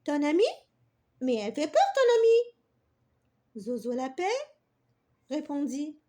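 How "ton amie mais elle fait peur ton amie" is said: put-on louder disbelieving little girl; wide-eyed doubt about the bee; astonished. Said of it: "Sophia était étonnée". (fillette étonnée et incrédule)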